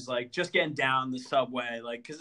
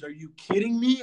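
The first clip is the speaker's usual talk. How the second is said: mock exasperated; 7 semitones higher, 5 dB louder than usual. Woman exasperated at him.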